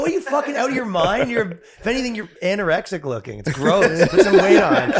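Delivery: high, loud laughing rant; mock rant. Boyfriend scolding his tiny ex, loud high laughing rant. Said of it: "I go".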